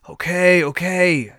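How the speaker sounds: usual speaking voice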